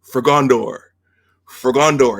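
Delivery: rallying shout, loud and proud; hammed-up rallying cry; battle cry. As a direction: call it out like a captain to troops.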